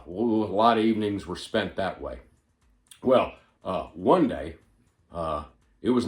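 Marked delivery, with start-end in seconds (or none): none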